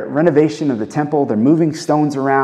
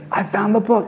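excited discovery; 8 semitones higher than usual. Acted. Preacher imagines spotting the Bible app, excited. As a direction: let the pitch leap with delight. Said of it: "give me that kind of excitement".

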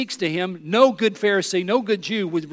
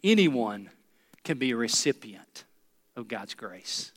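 dictated slowly; 8 semitones lower, 5 dB quieter than usual. Shown as a demonstration. Preacher dictating the lesson to the congregation, slow and spaced. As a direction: lower and softer, spaced out phrase by phrase for note-taking. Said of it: "Write it down".

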